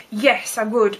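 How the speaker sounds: usual speaking voice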